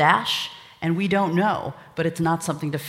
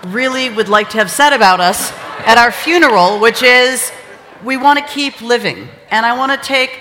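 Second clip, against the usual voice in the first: high, loud address over laughter; raised voice; 6 semitones higher, 11 dB louder than usual. Real, not acted. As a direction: project to a laughing crowd. Closing the night over laughter.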